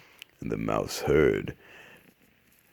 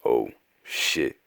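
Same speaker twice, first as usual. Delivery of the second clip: ordinary talk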